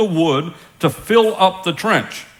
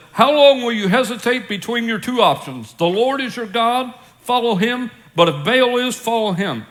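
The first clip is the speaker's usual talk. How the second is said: Elijah pleading; 6 semitones higher than usual. A narrator's reading. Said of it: "Elijah came near ... and said".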